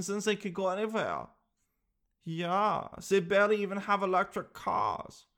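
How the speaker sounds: scornful alien with a hammed-up German accent. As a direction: sneer down at humans in a German accent. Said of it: "aliens have a German accent".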